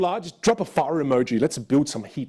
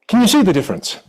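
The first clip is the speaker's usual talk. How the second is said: suddenly loud and higher-pitched; playing with the voice; 5 semitones higher, 8 dB louder than usual. Shown as a demonstration. Coach switching back to show the class the difference, loud and lifted. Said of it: "One is using versus one is playing".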